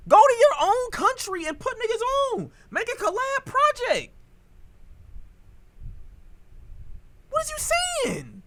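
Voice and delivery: worked up, voice climbing very high; loud, high-pitched exasperated challenge; real heated rant. Real, not acted. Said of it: "He got me hot".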